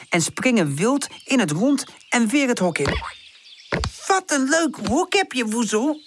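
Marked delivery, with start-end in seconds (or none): 4.1-6.0 high, lightly puffed compliment; a touch of panting after jumping; out of breath. A narrator's reading. Charlie to Woezel after jumping around, slightly breathless. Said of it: "nog nahijgend van het springen".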